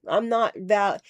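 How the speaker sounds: usual speaking voice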